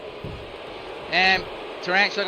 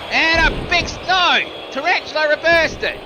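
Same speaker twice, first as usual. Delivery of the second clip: much higher and louder, shouting the reversal; excited shout; 9 semitones higher, 4 dB louder than usual. Acted. Ringside commentator shouting to viewers as a move is reversed, excited shout. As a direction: yell it like the crowd just erupted.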